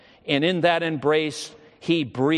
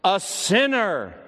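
higher, slow, stress on sinner; soul-poured utterance; 5 semitones higher, slower than usual. Retold or imitated. Dying boy confessing himself a sinner, slow and earnest. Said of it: "as though his soul went forth upon the utterance".

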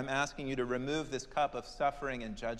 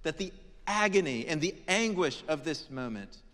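ordinary delivery

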